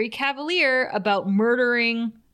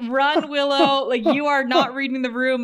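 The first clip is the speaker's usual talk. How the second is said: urgent warning; 4 semitones higher, 4 dB louder than usual. Real, not acted. Podcaster warns Willow she's misreading the room, loud and urgent. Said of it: "And I'm like".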